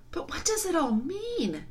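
put-on high-pitched, wondering girl's voice; high girl's question; puzzled girl. A narrator's reading. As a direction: ask in earnest bewilderment. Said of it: "when they were somewhat calmer".

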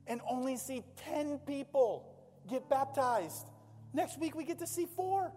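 high, urgent preaching; preaching at full force. Real, not acted. Pastor celebrating baptisms to church, high preaching. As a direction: ring it out to the congregation.

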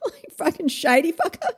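ordinary delivery

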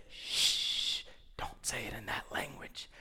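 urgent whispered hush. Retold or imitated. Hezekiah's men whispering urgently to the envoy. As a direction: hush him so the men won't hear.

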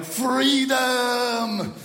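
high, drawn-out mock battle yell; hammed-up yell with a bad Scottish accent; William Wallace. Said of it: "imagine him yelling".

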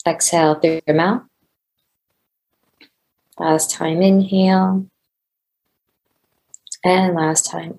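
measured meditation guide, drawing out phrase ends; guided meditation. Real, not acted. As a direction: lead listeners unhurriedly into breathing and settling.

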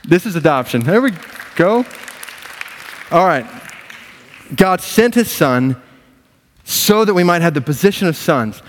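high, loud, exultant sermon delivery; full-force preaching. Acted. Preacher rallying church over the good news, exultant. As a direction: build to a high, ringing proclamation.

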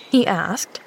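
plain reading voice

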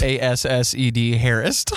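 slow letter by letter spelling. Shown as a demonstration. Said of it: "Can you use it in a sentence?".

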